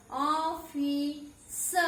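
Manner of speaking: Office spoken very slowly; slow demo. Shown as a demonstration. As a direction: sound out office syllable by syllable. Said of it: "जब मैं office बोल रही हूँ". (Office को बहुत धीरे, अक्षर-अक्षर बोलें)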